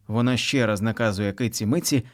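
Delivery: usual speaking voice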